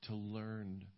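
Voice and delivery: hushed closing prayer to the Lord; leading prayer. Real, not acted. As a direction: pray aloud softly, with pauses between phrases. Leads the congregation in prayer.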